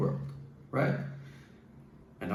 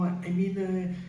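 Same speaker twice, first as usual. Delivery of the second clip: wrong voice demo; 7 semitones higher than usual. Shown as a demonstration. Coach showing men the voice not to use, pitched high. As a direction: pitch pushed up high, strained and hurt. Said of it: "make your voice like this".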